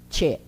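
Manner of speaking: "Tchê" short, with a ch-as-in-church onset; isolated "Tchê", crisp tch; gaúcho TCH sound. Shown as a demonstration. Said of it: "ele tem esse som assim".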